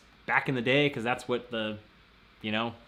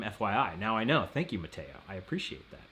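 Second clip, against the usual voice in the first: ordinary talk, nothing marked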